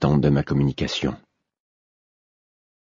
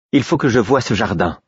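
eager, higher exclamation; exclaiming; 4 semitones higher than usual. A narrator's reading. The queen, thrilled by the king's message, exclaiming eagerly. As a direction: burst out with sudden joy. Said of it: "s'exclama-t-elle".

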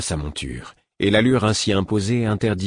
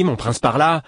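"mon prince par-là" higher and louder, the anger peaking on "mon"; mock his title back at him, building anger; angry echo, rising; 7 semitones higher, 4 dB louder than usual. A narrator's reading. Prince mocking the formal title to his friend, angry. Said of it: "s'exclama-t-il avec colère".

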